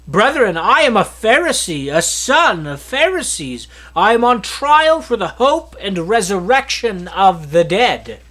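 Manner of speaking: loud, pleading cry to the council. Acted. Paul cries out to the divided council, loud and urgent. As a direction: raise the voice and call out to the whole council. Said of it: "Paul began crying out in the council".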